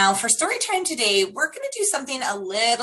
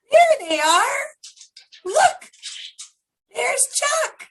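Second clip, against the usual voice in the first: excited spotting. Real, not acted. Teacher showing children the frogs on video, excited high exclaim.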